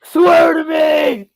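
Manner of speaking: slow, high, shouted gravel. Pretend. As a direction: bark it like Bale's Batman.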